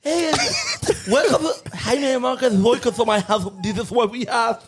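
lightly voiced host; mock welcome host; imitating Chris. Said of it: "you gonna speak like that".

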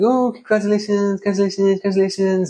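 high, sing-song congratulations on repeat; lightly voiced chorus of aunts; mock gushing relatives. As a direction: parody of family chat cooing. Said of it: "everyone was like".

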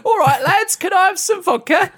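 very high, squeaky joke voice; comic lad voice. Acted. Lad on a horse asks the men in the shed, high comic voice. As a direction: pipe up as a whistling lad arriving.

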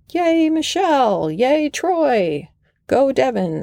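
high, bright cheering calls; crowd cheering. Retold or imitated. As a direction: imitate a roadside crowd cheering runners on. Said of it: "the crowd would yell out".

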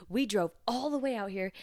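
annoyed customer. Retold or imitated. Angry customer complaining.

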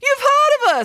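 very high, loud, pleased surprise; surprised nanny. Acted. Nanny hearing that Derek knows her cousin, surprised. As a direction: pitch it right up in surprise.

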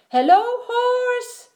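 high, loud, stretched greeting; Duck calling out. A narrator's reading. Duck greets Horse while riding, calling out high and slow. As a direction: draw out a high hello as Duck.

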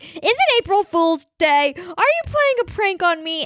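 suspicious dad. Retold or imitated. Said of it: "And he's like".

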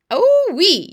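loud high yelp; pained yell. A narrator's reading. BFG reacts to Sophie in his ear, pained yell. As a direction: yell out as if your ear hurts. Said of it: "yelled the B F G".

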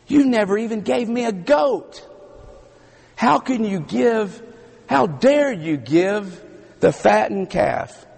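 put-on the resentful older brother demanding his due; aggrieved protest. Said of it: "he starts adding it up and he says".

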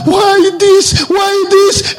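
high, pleading cry; crying out to God. Retold or imitated. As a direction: cry out in anguish, why this. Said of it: "He was crying".

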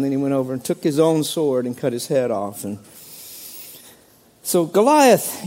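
ordinary delivery

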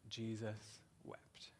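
slow, breathless whisper of Jesus wept; whispered verse. Acted. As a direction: let the verse sink in, whispered. Quoting John's answer to guilt.

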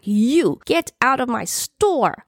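high, drawn-out bellow without much volume; Mama Rosa. A narrator's reading. Store owner from the back to troublemaker, bellowing. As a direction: order him out with a long bellow. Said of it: "Mama Rosa's voice bellowed".

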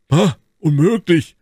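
high, loud cry of disbelief; surprised cry. A narrator's reading. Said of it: "rief Porthos". (lauter, hoher Ausruf des Unglaubens)

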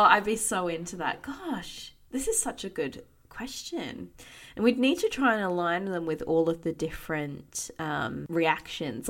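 usual speaking voice

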